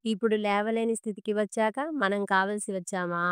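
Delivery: ordinary delivery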